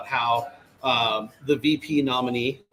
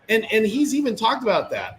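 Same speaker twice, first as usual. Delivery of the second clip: ordinary talk, nothing marked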